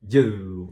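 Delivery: "you" as one low, held beat; one single syllable. Shown as a demonstration. Teacher counting syllables for learners, "you" as one beat. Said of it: "uma sílaba".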